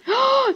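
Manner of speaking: loud, high gasp; startled gasp. Real, not acted. Gamer spotted while scoping, startled gasp. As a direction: suck in air in alarm.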